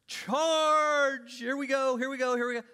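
shouted charge, high and loud; rally the troops like a charging army; mock battle charge. Preacher acting eager army charge.

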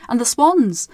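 usual speaking voice

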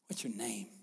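"What's your name?" in a breathy whisper; whispered question. Shown as a demonstration. Jesus tenderly asking the man his name, whispered. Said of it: "Such a kind, gentle, relational thing".

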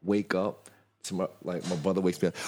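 ordinary delivery

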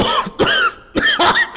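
high strained coughing fit; hacking cough. Acted. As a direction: cough like your lungs are burning. Poisoned by nerve gas.

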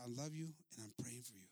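low, soft, calm declaration; gentle sincerity. Acted. Widow to the angry chief, gentle sincerity. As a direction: drop low and soft, tenderly. Gentle words after outburst.

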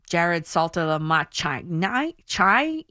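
"Saltalamacchia" slowly fumbled as "-ma-CHAI", questioning; mock mispronunciation. Shown as a demonstration. Host mocking critics of her pronunciation, halting wrong reading. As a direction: sound out "Saltalamacchia" as spelt, ending in CHAI. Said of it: "I can't even say it without saying it the Italian way".

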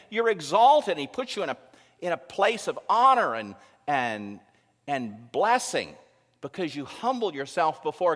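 loud, high, driving sermon delivery; full-force preaching. Acted. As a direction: preach it out to the whole congregation.

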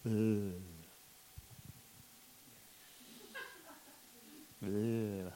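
low, soft flat groan; a deflated groan with no energy; slumped groan, nothing left; groan of apathy. Retold or imitated. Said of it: "still sat there feeling".

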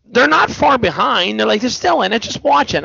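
high-pitched nagging from relatives; mock yelling. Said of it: "my brother-in-law was yelling at me too".

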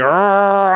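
high, loud straining "arr" noise; anxious growl. Retold or imitated. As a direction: imitate a kid groaning with nerves. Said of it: "Just anxiety".

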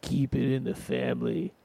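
a hint of a Jersey mafioso; mafia voice. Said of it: "that's my mafia voice".

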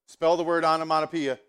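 loud, level test announcement; teacher's loud dictation; teacher giving orders. Acted. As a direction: call out the test word to the class. Teacher springing a pop test.

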